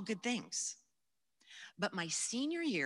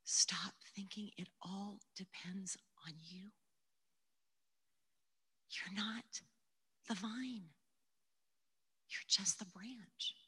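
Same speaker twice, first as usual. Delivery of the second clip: gentle whisper; 6 dB quieter than usual. Retold or imitated. Jesus speaking gently to her.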